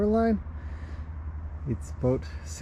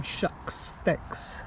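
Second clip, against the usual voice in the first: ordinary talk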